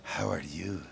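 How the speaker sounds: quiet coaching line; soft prompt. Shown as a demonstration. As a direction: whisper the line for her to copy.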